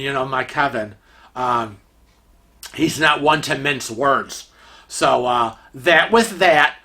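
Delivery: usual speaking voice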